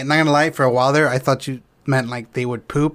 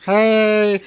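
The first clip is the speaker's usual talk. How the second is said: mock chirpy greeting, higher; imitating her chirpy hello; 6 semitones higher than usual. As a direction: imitate her bubbly hey guys. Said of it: "That's how she talks".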